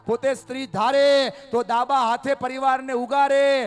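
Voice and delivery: loud, high preaching in full flow; fiery preaching. Acted. Speaker praising the women present, fiery preaching. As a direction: proclaim it like a sermon climax.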